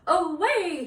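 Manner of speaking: loud, high rallying call; heroic cry. Acted. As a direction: cry out like a knight charging off. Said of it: "he cried".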